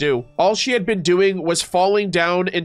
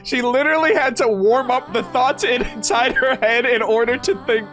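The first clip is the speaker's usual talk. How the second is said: reading through giggles, higher pitched; struggling not to laugh; 7 semitones higher than usual. Real, not acted. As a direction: push the line out while fighting a laugh. Rereads line after cracking up laughing.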